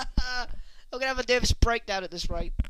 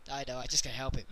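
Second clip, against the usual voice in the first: normal talking voice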